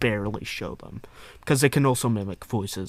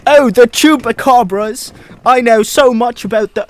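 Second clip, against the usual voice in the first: mock excited outburst; 12 semitones higher, 15 dB louder than usual. Co-host butting in on the chupacabra topic, mock excited. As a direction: blurt it out high and very loud.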